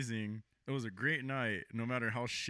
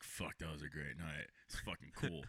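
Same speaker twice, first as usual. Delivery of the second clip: tired satisfied mutter; 12 semitones lower, 13 dB quieter than usual. Retold or imitated. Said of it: "but I was just like".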